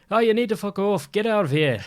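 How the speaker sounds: high, loud mock Irish accent. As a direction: belt out a telling-off in an Irish accent. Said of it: "if I redo the accent".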